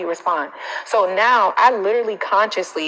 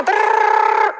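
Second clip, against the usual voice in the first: mocking noise; 5 dB louder than usual. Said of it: "Now you have toxic people".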